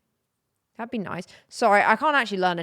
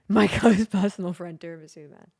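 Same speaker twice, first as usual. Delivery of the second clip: ordinary delivery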